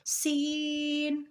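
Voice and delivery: سین opened on a sharp hiss, long "ee"; hard whistling s. Shown as a demonstration. Teacher repeating the rule's example, سین hissed and drawn out. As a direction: whistle the s, stretch سین out slowly. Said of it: "سختی سے پڑھا جائے گا".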